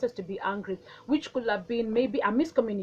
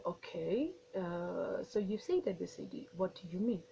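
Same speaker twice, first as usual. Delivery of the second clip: 1 calm soft reply; 4 semitones lower, 11 dB quieter than usual. Shown as a demonstration. Calmly voicing a reply to insult.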